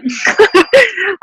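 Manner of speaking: high, breathy laugh; embarrassed laugh. Real, not acted. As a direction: laugh it off, a little flustered. Laugh about overweight bag.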